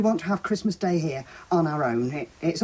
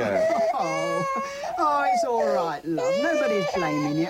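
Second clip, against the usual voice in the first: put-on soothing a child; 10 semitones higher, slower than usual. Grandmother soothing the baby.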